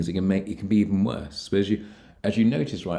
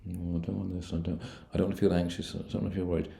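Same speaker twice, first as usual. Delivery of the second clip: softer, mopey imitation of a worried client; mopey complaining; 6 dB quieter than usual. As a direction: imitate someone moaning what they don't want, softly. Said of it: "I see people like this".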